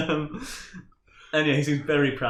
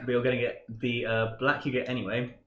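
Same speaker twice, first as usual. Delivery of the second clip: normal talking voice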